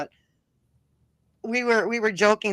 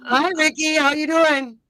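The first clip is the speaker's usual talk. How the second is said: excited greeting; 8 semitones higher, 6 dB louder than usual. Real, not acted. Greeting a friend watching.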